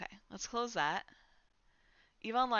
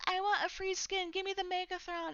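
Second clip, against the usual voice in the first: mocking a begging viewer, pitched much higher; mock whiny viewer; 10 semitones higher than usual. Mimicking stream viewers begging for a free skin, whiny and high. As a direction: whine it like an entitled kid. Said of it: "people will come into my stream and be like".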